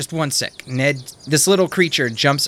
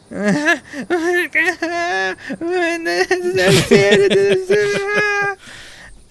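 loud wailing; 5 dB louder than usual. Acted. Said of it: "Tim starts crying even louder".

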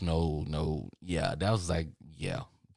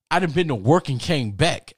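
loud, higher-pitched insistence; acted-out roommate setting him straight; emphatic revelation; 7 semitones higher, 7 dB louder than usual. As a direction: belt out the news in disbelief.

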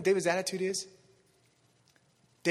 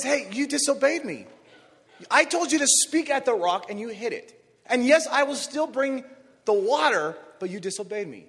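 scolding God; 5 semitones higher, 7 dB louder than usual. Acted. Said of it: "God says".

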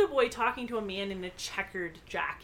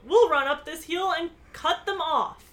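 raised, louder story yell; 7 semitones higher, 6 dB louder than usual. A narrator's reading. Bess shouts a plan to her cousin, yelling. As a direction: call it out urgently, starting high. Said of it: "who yelled".

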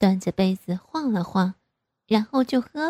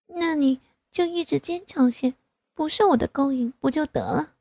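much higher, coy teasing; coy daughter; 6 semitones higher than usual. A narrator's reading. Daughter coyly teasing her father, higher voice. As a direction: dare him coyly. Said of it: "我娇羞地说".

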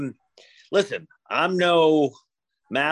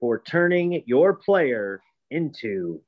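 ad read. Acted. Ad read with promo code.

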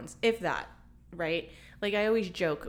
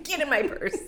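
laughing; 7 semitones higher, 5 dB louder than usual. Real, not acted. Laughing joke repeat.

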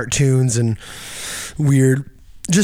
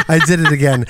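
ordinary talk

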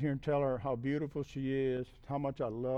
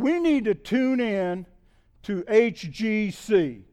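loud, higher-pitched sermon delivery; impassioned preaching; 4 semitones higher, 9 dB louder than usual. Real, not acted. Preacher urging congregation to tune in to God, loud and fervent. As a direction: drive the message home forcefully to the congregation.

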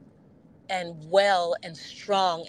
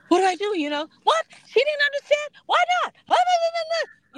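much higher, louder panicked rant; mock freak-out; 4 dB louder, faster than usual. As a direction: squeal it like you're losing it. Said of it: "I may be freaking out going".

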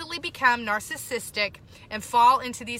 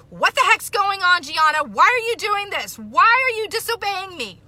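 high, loud, exasperated parent outburst; mock angry scolding; 9 semitones higher, 9 dB louder than usual. Mother flying off the handle at her daughter, angry and loud. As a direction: blow up at the kid over nothing.

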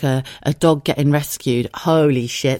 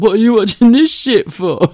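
loud, raised mock-scornful voice; mocking husband; 7 semitones higher, 7 dB louder than usual. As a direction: imitate a husband jeering at the telly choice. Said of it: "otherwise I get mocked by my husband".

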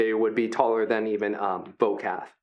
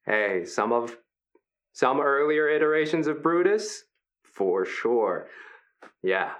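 a lightly higher, friendly voice for a huge gentle creature; friendly hulking Brutus; 3 semitones higher than usual. Acted. Big gentle wrestler Brutus welcoming the new fighters, upbeat. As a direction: play the big guy as sweet and encouraging.

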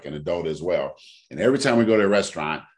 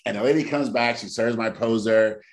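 ordinary talk, nothing marked

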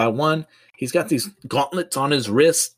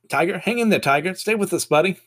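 urgent plea; 8 semitones higher than usual. Acted. Mary Jane urging fading Peter on.

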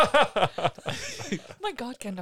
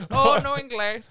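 mock Spanish; 5 semitones higher, 9 dB louder than usual. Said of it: "Let's pretend we're Spanish".

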